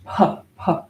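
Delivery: "Puh, puh" as separate breathy puffs; aspirated P. Shown as a demonstration. Teacher demonstrating an aspirated P H, puffed "Puh, puh". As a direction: pop each "Puh" with a burst of air. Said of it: "it's an aspirated P H".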